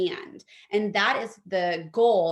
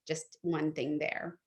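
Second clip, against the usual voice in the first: ordinary talk, nothing marked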